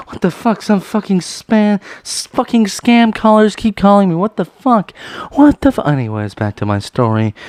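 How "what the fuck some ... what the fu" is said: high, loud irritated rant; annoyed outburst. Real, not acted. Ranting at scam callers interrupting the video, irritated. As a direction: vent at the spam calls.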